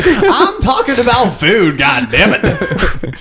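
high, slow, drawn-out yell; laughing shout that drops in pitch at the end; exasperated shout. Real, not acted. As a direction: holler in laughing protest, dragging each word. Protesting over friends' running joke.

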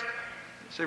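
ordinary delivery

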